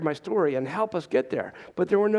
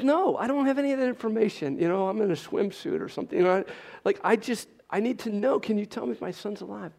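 higher-pitched, desperate protest, retold; exasperated plea; 5 semitones higher than usual. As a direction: act out a frustrated father begging for an answer. Retold desperate plea to hospital clerk.

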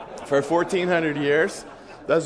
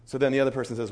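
ordinary talk

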